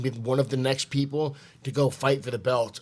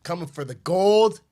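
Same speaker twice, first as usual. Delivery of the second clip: trash talk callout; 3 semitones higher, 9 dB louder than usual. Real, not acted.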